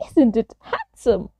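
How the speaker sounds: lightly voiced admiring woman; a hint of an exclaiming woman; admiring woman's cry. A narrator's reading. Woman seeing the new tree, lightly voiced admiring cry. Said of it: "cried the woman".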